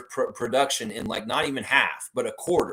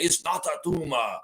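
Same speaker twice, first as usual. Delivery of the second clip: higher-pitched mock Austrian action-star voice; Arnold Schwarzenegger; 5 semitones higher than usual. Host showing how a player's accent slips, mock Austrian impression. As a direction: put on a thick comic Austrian accent. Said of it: "he'll sound like Arnold Schwarzenegger".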